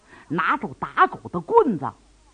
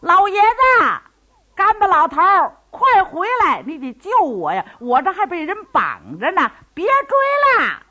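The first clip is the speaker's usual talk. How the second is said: much higher, louder desperate yelling; tied-up man yelling for help, high and loud; shouting from behind; 10 semitones higher, 5 dB louder than usual. A narrator's reading. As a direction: holler after the old man to come back. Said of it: "唐铁牛在后边就嚷啊".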